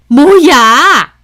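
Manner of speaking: loud, drawn-out outraged yell; angry yell. Acted. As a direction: burst out as a furious mother-in-law. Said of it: "소리를 빽 지르시는 겁니다".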